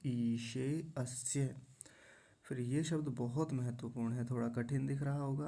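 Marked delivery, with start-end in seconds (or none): 0.1-1.6 'ईशे अस्य' slowly, long ee and clear sh; careful recitation. Shown as a demonstration. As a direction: draw out each syllable of 'ईशे अस्य'. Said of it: "दोनों को मिला लेंगे".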